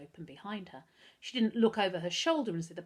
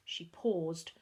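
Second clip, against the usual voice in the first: ordinary delivery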